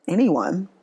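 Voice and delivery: ordinary talk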